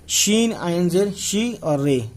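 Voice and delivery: letters named one by one, very slowly; slow classroom dictation; very slow spelling. Shown as a demonstration. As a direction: spell it out deliberately for beginners. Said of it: "teacher spells out letters".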